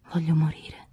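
soft, voiceless near-whisper; said softly. Acted. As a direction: breathe the wish out under her breath. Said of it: "Dico a bassa voce".

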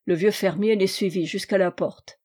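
usual speaking voice

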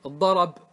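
الضرب said "ad-darab", a after the r; fatha moved back. Shown as a demonstration. Teacher quizzing on transferred vowel, الضرب as "ad-darab". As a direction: put an a on the r in الضرب, ending on b. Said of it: "نقلت الفتحة".

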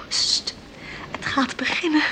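hushed whisper, barely voiced. Mother hushing at the concert, whispering. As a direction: hush them as the concert starts.

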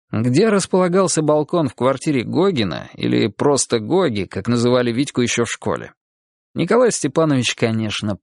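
ordinary delivery, nothing marked

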